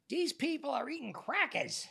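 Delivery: high, slow whiny accusation; imitating a confused old man. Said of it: "Like this".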